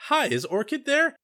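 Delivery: high-pitched polite caller; light, high phone voice; chirpy phone greeting. Retold or imitated. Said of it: "she calls the lab and".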